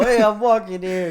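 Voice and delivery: loud, high imitation New Yorker holler; hammed-up New York street shout; mock New York accent. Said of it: "If you do the".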